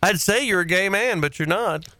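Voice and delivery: normal talking voice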